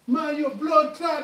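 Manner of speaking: put-on high, slow, loud Jamaican accent. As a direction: lean into a heavy Jamaican accent, drawn out and threatening. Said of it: "he's got a strong accent".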